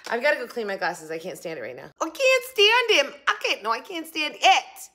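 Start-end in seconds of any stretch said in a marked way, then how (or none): 2.0-4.9 very high, louder squeaky starlet; shrill squeak like a silent film star; Lina Lamont voice. Retold or imitated. Showing viewers how the actress talks in the film. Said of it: "repeats the movie line".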